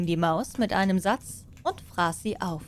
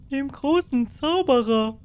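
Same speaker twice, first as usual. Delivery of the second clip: loud, higher voice of field workers; peasants answering; 5 semitones higher, 5 dB louder than usual. Acted. Farmhands shout back to the cat, loud and high. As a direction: call back as the mowers in the meadow.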